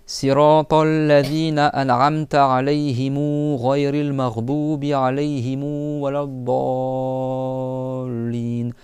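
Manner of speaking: slow, drawn-out chanted recitation; chanting the end of the Fatiha slowly; Quran recitation. Acted. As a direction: recite it slowly, holding the long vowels.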